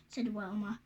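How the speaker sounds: plain reading voice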